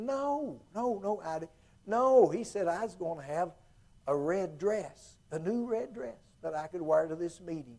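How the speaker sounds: high, soft, insistent country woman; lightly voiced [name] pleading.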